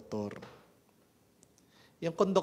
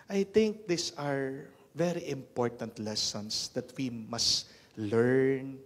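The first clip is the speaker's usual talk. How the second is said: ordinary talk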